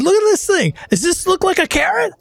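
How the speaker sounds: very high, outraged challenge; indignant confrontation. Acted. Customer showing the finger to the innkeeper, indignant. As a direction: demand an answer, incredulous.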